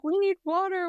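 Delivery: high-pitched mock plea; imitated villagers. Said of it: "They're like".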